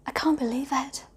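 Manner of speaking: breathy whisper to herself; whispered aside. Real, not acted. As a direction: murmur it in disbelief, just for yourself. Amazed aside about twenty years.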